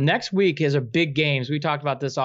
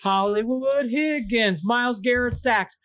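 higher, rising fan chant; excited cheer; 8 semitones higher, slower than usual. Pretend. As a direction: cheer it like the winning play.

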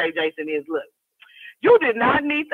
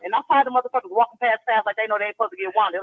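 normal talking voice

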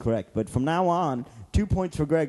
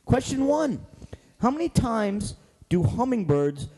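quiz host; 4 semitones higher, slower than usual. Acted.

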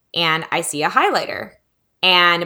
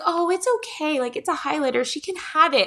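high, gentle easygoing voice; imitated reassurance; 9 semitones higher than usual. Cashier telling mom the child can keep it, imitated reassurance. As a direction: play a kindly cashier letting it go.

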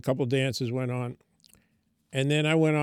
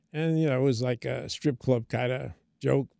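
usual speaking voice

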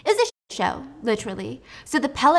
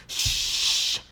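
whispered shush. Retold or imitated. Shushing Wylan.

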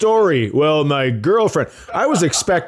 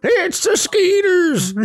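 put-on very high, slow old geezer voice; old man voice; slower than usual. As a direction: drag it out like a cranky old man.

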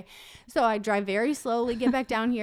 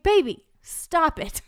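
louder, drawn-out pleading protest; mock exasperation; 5 semitones higher, 5 dB louder, slower than usual. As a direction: stretch it slow and high in mock reproach. Mock scolding her partner in retelling.

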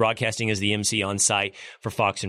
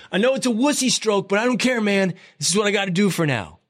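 much higher, hammed-up shout across the water; Hegseth's mock yell; 9 semitones higher, 3 dB louder than usual. Imitating Hegseth yelling to Kaj while floating, high mock shout. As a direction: call out for your swim buddy like a worn-out swimmer. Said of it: "and yelled".

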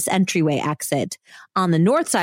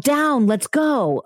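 mild mock yell, higher and drawn out; retold yell; 6 semitones higher, slower than usual. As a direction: call it out slowly, pitched up, without full volume. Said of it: "I yelled".

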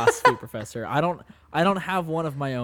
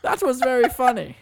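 high, loud, chuckling professor; pompous praise, pitched up high; Professor Dicklecunt; 11 semitones higher, 6 dB louder than usual. Acted. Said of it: "You made Professor Dicklecunt chuckle".

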